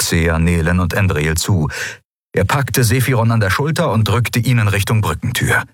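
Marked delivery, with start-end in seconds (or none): none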